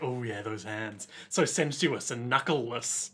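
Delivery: low, soft swooning murmur; imitating Kira. Host voicing Kira swooning over hands, low murmur. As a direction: croon it like a creepy admirer. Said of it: "Kira was just like".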